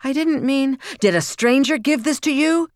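high, loud furious questioning; father shouting accusations at his child; angry shouting. A narrator's reading. Furious father interrogates his daughter.

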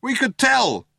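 high shout back; Ford shouting. A narrator's reading. Ford replying to the cop who shot at them, shouting. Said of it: "shouted Ford".

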